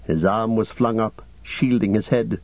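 plain reading voice

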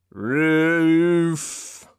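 creaky announcing call; creaking voice. Acted. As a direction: bark out the floor like a worn-down liftman. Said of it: "called a creaking voice".

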